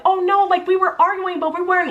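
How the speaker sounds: high, sing-song mockery of his excuse; mocking impression. Ex-wife mocking her ex's lie to the camera, high and scornful.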